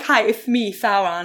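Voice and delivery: Thauron with a lisp, th for s. Pretend. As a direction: say Thauron with a lisped th. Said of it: "sounds like you have a speech impediment".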